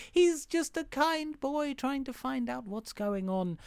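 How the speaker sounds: high, drawn-out mock innocence; mock-innocent pleading. Podcaster defending the misunderstood wolf, mock-innocent pleading. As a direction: plead sweetly in a high, slow voice.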